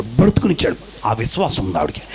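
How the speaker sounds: ordinary talk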